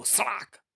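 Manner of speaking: high-pitched vocal lash; whip crack sound. Acted. Narrator voices the whip crack. (कोड़े की ऊँची सड़ाक)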